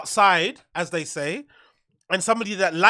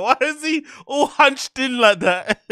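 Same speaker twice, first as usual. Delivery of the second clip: higher, slower, breaking into laughter; amused laughing; 5 semitones higher than usual. Real, not acted. Host watching a man hunched in, amused laughing. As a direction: laugh through the question, then let it go.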